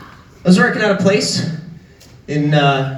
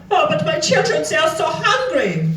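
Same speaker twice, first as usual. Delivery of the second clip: mock whiny customer; 6 semitones higher than usual. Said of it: "And then he went".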